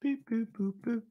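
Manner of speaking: high squeaky cartoon boops; silly clown. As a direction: chirp each boop like a toy. Cartoon sound effect in lesson.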